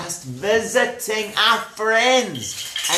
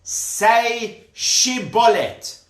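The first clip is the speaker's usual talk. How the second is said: slow, raised guard's command; challenging guard; 5 semitones higher, much slower than usual. Acted. Guards test somebody crossing the river, slow command. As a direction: order it slowly, word by word. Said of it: "they would say to them".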